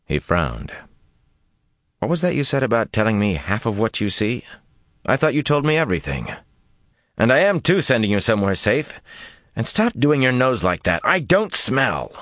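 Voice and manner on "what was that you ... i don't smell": lightly voiced young woman, higher and quick; Min, scolding. A narrator's reading. Min's voice in narration.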